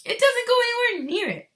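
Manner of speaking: high, loud eager voice; excited Wonka. Acted. Wonka assuring the mother, high and loud. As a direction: insist brightly.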